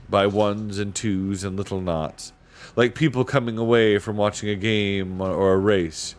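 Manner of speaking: usual speaking voice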